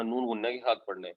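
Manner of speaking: ordinary talk, nothing marked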